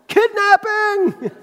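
high, drawn-out mock shriek of a kid; hammed-up child yelling kidnapping; mock child scream. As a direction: squeal it like a toddler crying kidnapping. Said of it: "I made that part up".

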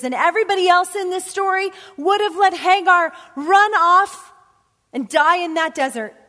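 builds to a loud, high preaching climax; full-voiced sermon climax; preaching at full force. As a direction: rise to a ringing peak. Preaching crescendo.

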